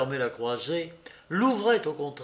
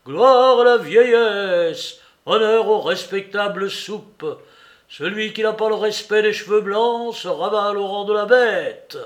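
mock solemn shouting; 6 semitones higher, 9 dB louder than usual. Letondu shouts mock praise of Soupe through the wall. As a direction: declaim it like a mock tribute.

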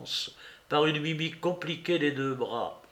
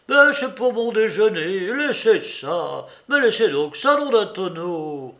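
alarmed pleading; 6 semitones higher, 7 dB louder than usual. Acted.

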